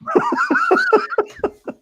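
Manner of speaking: loud, high-pitched burst of laughter. Real, not acted. Host cracking up mid-stream, high loud laugh. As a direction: let out a high, squealing real laugh.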